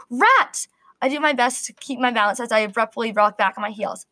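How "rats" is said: high, loud shriek of fright; fearful shriek. A narrator's reading. Apprentice finding rats in the fruit, shrieking in fear. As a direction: scream it as the rats jump out. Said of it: "shriek of pure fear".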